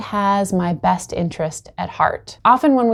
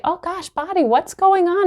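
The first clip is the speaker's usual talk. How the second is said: gentle, pitched-up caring voice; tender concern; 8 semitones higher than usual. Retold or imitated. Speaking kindly to her own aching body, tender. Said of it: "we turn towards the body and say".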